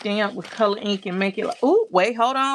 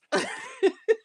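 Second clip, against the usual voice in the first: high delighted laugh. Real, not acted. Laughing after her cry.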